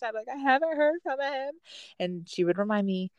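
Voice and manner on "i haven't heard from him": high, mock-tearful wail; retold tearful call. Wife calling mom about deployed husband, mock tearful. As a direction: imitate crying down the phone. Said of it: "call my mom in tears".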